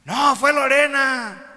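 loud, slow, high denial; shouted accusation. Acted. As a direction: yell back, pointing at someone else. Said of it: "Y algunos gritaron".

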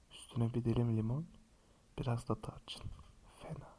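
conspiratorial whisper. Real, not acted. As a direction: whisper it like a secret. Secret drink recipe, leaning in. (fısıltıyla)

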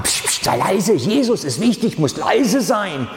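hoch und gedämpft zischend; tuschelnde Menge. Retold or imitated. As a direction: bring ihn hektisch zum Schweigen. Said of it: "stehen sie da und machen". (hoch, leise und zischend)

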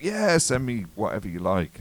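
eager. Retold or imitated. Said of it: "I'm like".

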